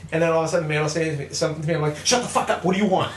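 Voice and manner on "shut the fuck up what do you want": retold angry snap. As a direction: act out snapping at an interruption. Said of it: "when I'm playing and I'm wicked angry".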